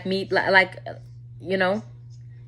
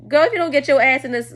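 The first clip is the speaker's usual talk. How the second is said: higher, louder mock outburst; imitated angry boyfriend; 6 semitones higher, 6 dB louder than usual. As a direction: play the boyfriend ordering her into the car. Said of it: "look crazy to me and be like".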